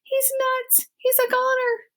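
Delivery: very high squeaky panic; panicked mouse. Acted. Furlough panicking about his brother, high squeaky alarm. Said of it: "shouted Furlough again".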